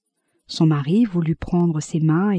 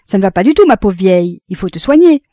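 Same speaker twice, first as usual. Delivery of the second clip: loud, insistent worry; blustering concern; 9 dB louder, faster than usual. A narrator's reading. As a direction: press her loudly to take care. Husband alarmed by wife's pallor.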